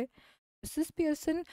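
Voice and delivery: ordinary delivery, nothing marked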